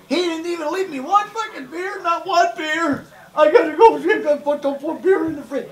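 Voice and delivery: high, laughing, whining sob-rant; mock crying rant. Brother complains to mom about the empty fridge, mock-crying. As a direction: whimper and whine about the beer. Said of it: "he's crying".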